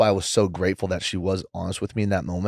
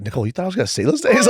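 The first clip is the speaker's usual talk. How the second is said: fast, much higher, laughing through the words; laughing disbelief; 12 semitones higher, 6 dB louder, much faster than usual. Retold or imitated.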